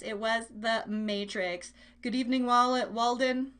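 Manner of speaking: ordinary talk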